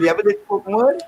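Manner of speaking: ordinary talk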